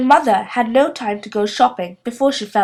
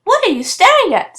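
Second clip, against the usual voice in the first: put-on a light, higher girl's voice, cheeky; cheeky Pippi; 9 semitones higher, 4 dB louder than usual. A narrator's reading. Pippi teases the staring children, light cheeky voice. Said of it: "said Pippi".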